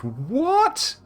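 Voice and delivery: very high rising yelp; incredulous squeal. Real, not acted. Reader baffled by the joke's wording, incredulous high squeal. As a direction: shoot up high in disbelief. Breaks off at an absurd line.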